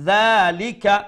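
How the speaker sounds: loud "যালিকা", first vowel drawn out; light dh and long aa in "যালিকা"; one-alif stretch. Shown as a demonstration. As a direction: hold the opening vowel of "যালিকা" for a beat. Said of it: "এক আলিফ লম্বা করিয়া".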